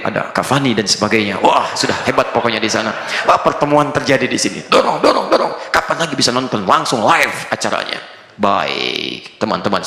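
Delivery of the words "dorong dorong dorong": mock football-fan cheer. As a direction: egg the players on from the couch. Fan shouting at the TV.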